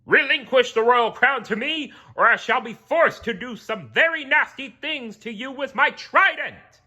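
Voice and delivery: high, loud threatening royal voice; a grand villainous warning; menacing king. Acted. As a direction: threaten him with the trident.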